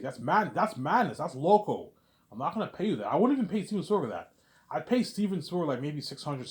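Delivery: high, loud exasperated protest; outraged refusal. Acted. [name] refuses Peter's salary demand, outraged protest.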